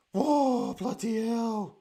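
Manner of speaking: loud, high whoa, then a slow bloody hell; relieved exclamation. Real, not acted. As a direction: let the shock out. Relief after a scare.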